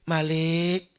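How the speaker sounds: very slow drawn-out wail, fading; pleading call. Acted. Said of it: "berteriak-teriak merayu".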